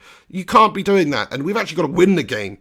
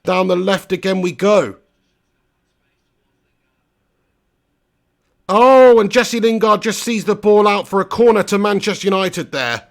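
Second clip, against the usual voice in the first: higher, louder match commentary, brisk calls; live play-by-play; 3 semitones higher, 5 dB louder than usual. Acted. Streamer calling the United attack, live commentary. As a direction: call the action as it happens.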